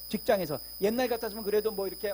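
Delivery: normal talking voice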